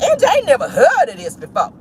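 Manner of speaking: high, put-on disbelief; surprised. Vlogger tells Angie she never heard this, high mock shock. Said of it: "I was like".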